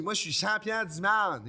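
high, loud, slow wrestler's boast; big drawn-out champion announcement; catchphrase proclamation. Retold or imitated. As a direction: proclaim it like a ring catchphrase, stretched out. Acting out his catchphrase in accent.

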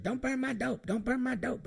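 high pleading warning; Diamond's voice. Retold or imitated. Imitating Diamond while he smokes, high fussy voice. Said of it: "she's like".

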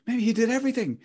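high-pitched speculation; mock wondering. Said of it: "you're left wondering".

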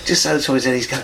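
ordinary delivery

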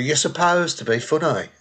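Brummie heckler's put-down; Brummie accent. Acted. T-shirt slogan from the heckle, Brummie accent. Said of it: "sounds better in a Brummie accent".

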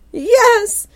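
high, loud yelp of admission; cracking under questioning. Acted. Suspect caving to interrogators over the peanut, high shout. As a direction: blurt it out at full pitch.

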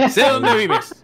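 high, laughing mock menace; laughing threat. Joking threat over shared photo.

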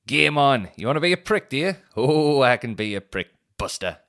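loud, high game on taunt; playful loud taunt to the annoyed man; mock challenge. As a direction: rise up loud to take the challenge. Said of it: "and I was like".

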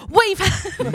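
put-on voice. Reading the magazine aloud to friends in a put-on voice. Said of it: "Do the voice".

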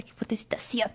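whispered in disbelief; hushed shocked whisper; stunned whisper. Retold or imitated. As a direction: breathe it out, stunned.

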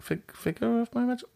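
«figure of my magic» высоко и тихо, наигранно запинаясь на «fig-»; сбивчиво, выше и тише, как будто теряя слова; mock fumbling. Shown as a demonstration. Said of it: "I'm not very good with the words".

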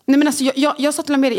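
ordinary talk, nothing marked

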